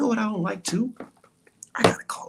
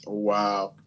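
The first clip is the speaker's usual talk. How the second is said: normal talking voice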